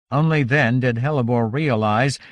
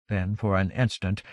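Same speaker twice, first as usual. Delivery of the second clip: ordinary delivery, nothing marked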